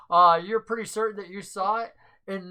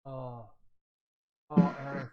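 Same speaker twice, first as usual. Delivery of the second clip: low, hushed grief; dropped, subdued voice of mourning; saddened; 6 semitones lower, 5 dB quieter than usual. Acted. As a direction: take the bad news softly. NPC learns of friend's death.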